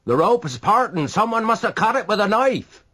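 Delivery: high, loud shout. A narrator's reading. The man in the boats shouting that the rope was cut. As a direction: yell up the discovery. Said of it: "shouted one of the men".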